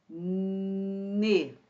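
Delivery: "Né" with a long held N; drawn-out dictation. Shown as a demonstration. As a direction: stretch the N of "Né" before releasing the vowel. Sounding out word while writing.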